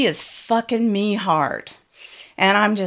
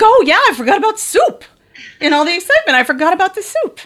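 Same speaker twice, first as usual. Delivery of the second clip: loud, high-pitched comic outburst; excited realization; 9 semitones higher, 6 dB louder, faster than usual. Retold or imitated. Woman suddenly remembering the soup, in a high, loud outburst. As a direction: burst out, pitch leaping up, as it dawns on you.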